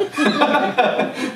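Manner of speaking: a burst of high laughter. Real, not acted. Pastor laughing at his own retort to the woman, high bright laugh.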